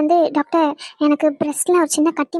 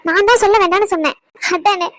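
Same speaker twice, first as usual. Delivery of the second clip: exasperated protest; 4 semitones higher, 4 dB louder than usual. Acted. Daughter protests to upset mother.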